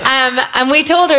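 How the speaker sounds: normal talking voice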